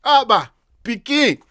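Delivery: loud, slowed Liberian street talk; playful Liberian pidgin; Liberian small-boy accent. Acted. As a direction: put on a Liberian accent, punchy and drawn out. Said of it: "small boy accent".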